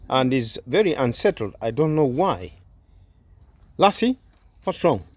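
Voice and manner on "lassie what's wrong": high, rising call to a pet; calling the dog. Real, not acted. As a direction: call the dog's name to get its attention.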